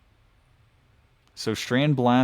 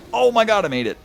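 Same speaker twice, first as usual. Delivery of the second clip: high, excited burst of relief; relieved excitement; 11 semitones higher, 6 dB louder, faster than usual. Real, not acted. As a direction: blurt it out, thrilled. Just made a hard jump.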